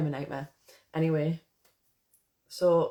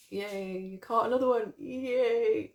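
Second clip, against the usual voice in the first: playful yay, rising higher; happy cheer; 5 semitones higher, 3 dB louder, slower than usual. Real, not acted. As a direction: cheer a friend's arrival. Cheering that viewer caught the stream.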